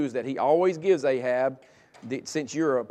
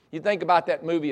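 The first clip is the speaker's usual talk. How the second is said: ordinary talk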